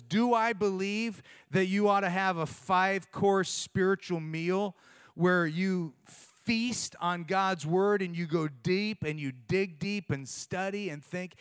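ordinary delivery

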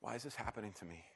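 hushed, imagined thought; inward questioning. Retold or imitated. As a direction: ask it softly, as if to yourself. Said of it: "has to be thinking to herself".

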